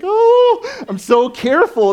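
high, loud, exaggerated parent soothing a child; mock-gentle parent leaning in over a child's eye; overly careful parent. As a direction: ham up the fussy careful mom. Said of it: "and I'm like".

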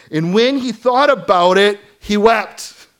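loud, high emphasis on thought; impassioned. Acted. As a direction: stress thought, cry it out. First reading of verse.

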